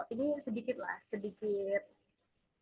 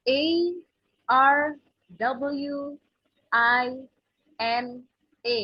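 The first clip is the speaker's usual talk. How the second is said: each letter of Arwina said apart, R loudest; teacher spelling a name in English letters; spelled letter by letter; 3 semitones higher, 7 dB louder, much slower than usual. Shown as a demonstration. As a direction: spell A R W I N A slowly with pauses. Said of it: "I want to spell it in English".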